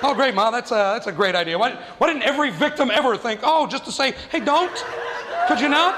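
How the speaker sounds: mock her idea with climbing sarcasm; sarcastic. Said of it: "sarcastic teen to mom".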